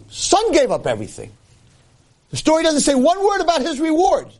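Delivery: high-pitched, insistent preacher's protest; impassioned preaching. Acted. Indignant preaching at raised pitch.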